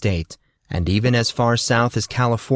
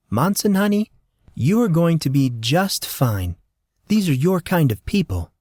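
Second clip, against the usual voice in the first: reassuring; 7 semitones higher than usual. Acted. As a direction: soothe a nervous boy. Molly reassuring him.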